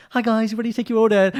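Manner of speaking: high, bright mock waiter voice; mock cheery waiter. Husband imitating the waiter taking their order, high and chirpy. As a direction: chirp it like an eager server. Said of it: "the person would be like".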